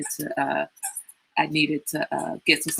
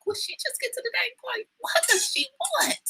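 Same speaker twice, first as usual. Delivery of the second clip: exasperated inner voice; 7 dB quieter than usual. Retold or imitated. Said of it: "I'm like".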